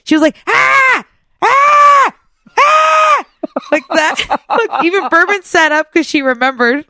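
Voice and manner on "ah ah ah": high, loud imitated screams of a frightened old lady; old lady's scream. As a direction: mimic the little old lady shrieking. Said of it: "I can hear her little voice".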